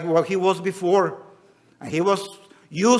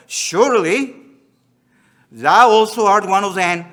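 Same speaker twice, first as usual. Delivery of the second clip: louder, higher accusation; accusing; 3 semitones higher, 7 dB louder than usual. Acted. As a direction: call him out. Bystanders accusing Peter.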